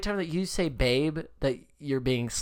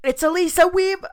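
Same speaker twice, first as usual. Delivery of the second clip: mock misreading; 13 semitones higher, 5 dB louder than usual. Mocking the misreading.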